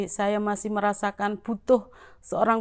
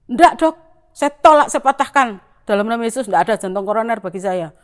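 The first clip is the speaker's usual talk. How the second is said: arguing refusal; 9 dB louder than usual. Retold or imitated. Patient loudly rejecting the cardiologist's diagnosis, defiant shout. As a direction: shout down the diagnosis in Jesus' name. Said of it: "saya tuh kayak tukaran".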